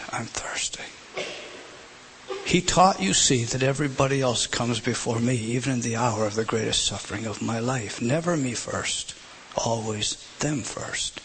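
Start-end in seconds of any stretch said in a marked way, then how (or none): 0.1-0.8 a faint, drained whisper of thirst. Acted. Christ's last cry retold. 8.0-11.2 slow and soft. Real, not acted. Sermon's climax, intimate.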